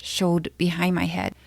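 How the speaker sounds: ordinary delivery, nothing marked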